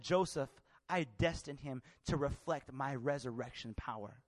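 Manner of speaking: low, slow, hushed divine message; quiet, measured prophecy; solemn voice of God. Retold or imitated. Quotes God speaking to him.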